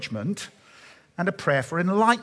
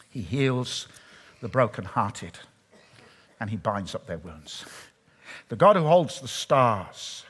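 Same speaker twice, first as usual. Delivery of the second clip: tender; 5 semitones lower than usual. Acted. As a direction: lower the voice to comfort the hurting. Tender turn to personal care.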